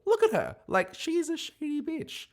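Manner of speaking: very high, incredulous squeal; high-pitched exclamation. Real, not acted. Host telling viewers not to trust a contestant, high incredulous squeal. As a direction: shoot up high in exaggerated disbelief.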